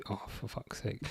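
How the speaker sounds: whispered annoyed curse; frustrated whisper under the breath; muttered curse. Real, not acted.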